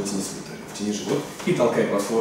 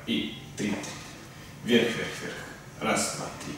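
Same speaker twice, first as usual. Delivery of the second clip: whispered counting; 8 dB quieter, slower than usual. Real, not acted. Coach counting reps under breath.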